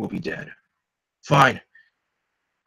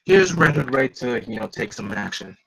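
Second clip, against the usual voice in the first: ordinary talk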